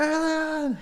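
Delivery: high-pitched pretend panic at an alien; mock freak-out. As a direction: freak out in a squeaky, hammed-up voice. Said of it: "some guy freaking out 'cause an alien's running at him".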